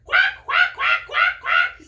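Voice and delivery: very high, very loud quacking duck; squawking cartoon duck, high and loud; loud duck shout. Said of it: "shouts Dottie".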